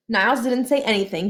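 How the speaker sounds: usual speaking voice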